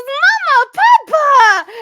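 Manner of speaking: high, loud joyful holler; delighted cry. Acted. Grendel barreling up to her parents, crying out happily. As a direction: burst out calling to the parents. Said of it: "and she goes".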